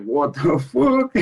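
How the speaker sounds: can't stop laughing at the news; disbelieving laugh breaking up the swear; laughing in disbelief. Real, not acted. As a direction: laugh through the words, slow and stunned. Stunned by news of the UFC deal.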